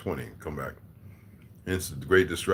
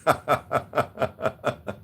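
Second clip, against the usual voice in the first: real rhythmic belly laugh; hearty laugh; 6 semitones higher than usual. Real, not acted. Streamer laughing at his chat, amused laughter. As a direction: laugh out in a long pulsing run.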